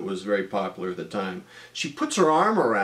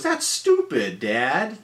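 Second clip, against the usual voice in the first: daughter's voice; slower than usual. Retold or imitated. Daughter voiced scolding dad.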